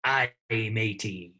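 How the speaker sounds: slow, growly pirate punchline. Pretend. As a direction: drag it out like an old pirate. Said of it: "What did the pirate say".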